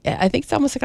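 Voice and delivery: normal talking voice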